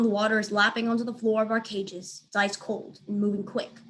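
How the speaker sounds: ordinary delivery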